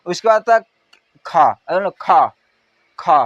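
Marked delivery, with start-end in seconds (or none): 1.2-3.2 खा with a strong puff of air, slowed; breathy aspirated kha. Shown as a demonstration. Teacher demonstrates aspirated sound to class. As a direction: push air hard on खा. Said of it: "मुंह से हमारे क्या तेज आ- हवा निकल रहा है".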